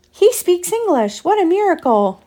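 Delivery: high put-on voice full of wonder; marvelling pilgrim. Pilgrim amazed the native bear speaks English, high voice. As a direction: marvel like you've seen a miracle.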